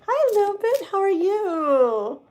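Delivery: high, delighted hello; light up to greet them; excited greeting. Real, not acted. Tarot reader greeting a live viewer, high and delighted.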